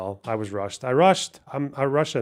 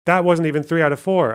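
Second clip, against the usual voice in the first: louder, indignant complaint; frustrated; 4 semitones higher, 7 dB louder than usual. Real, not acted. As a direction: vent the unfairness loudly. Frustrated at game result.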